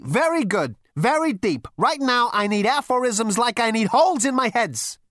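a hint of a brash galactic president; Zaphod's voice. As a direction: play the cocky young Zaphod. Zaphod sarcastic.

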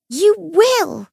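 high, loud commanding shout. Acted. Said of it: "Lucy shouted".

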